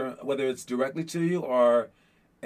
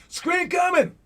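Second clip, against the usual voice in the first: calling out a screen; 12 semitones higher, slower than usual. Shown as a demonstration. Player calling out a screen to a teammate. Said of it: "You're saying it just in case".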